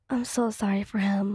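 plain reading voice

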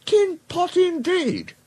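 very high, slow indignation; indignant retort. Acted. Duck defending the Great Western, indignant. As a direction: throw the insult back, drawn out and high.

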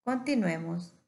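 ordinary talk, nothing marked

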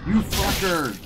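high, loud real exasperation; frustrated shout. Real, not acted. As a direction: yell at the broken camera. Stream glitch happens again.

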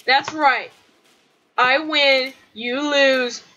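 normal talking voice

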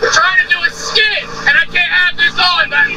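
shouting far across the yard; yelling. Real, not acted. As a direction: yell out to dad, high and strained. Yelling at dad from outside.

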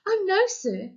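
mildly horrified, high-pitched denial. A narrator's reading. Boy answering a policeman's question about guns, horrified. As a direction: deny it in a high, startled voice.

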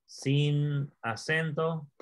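dictating while writing; slow dictation. Shown as a demonstration. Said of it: "lo voy a escribir". (dictando sin acento despacio)

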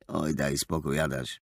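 low, soft murmur; quiet, low, irritated grumble; weary mutter. A narrator's reading. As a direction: mutter a brush-off under the voice. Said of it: "mruknął Rafał".

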